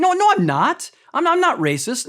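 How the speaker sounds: mock offended denial. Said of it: "You'd be really offended, like".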